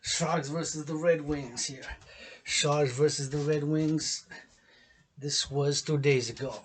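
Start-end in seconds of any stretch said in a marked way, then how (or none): none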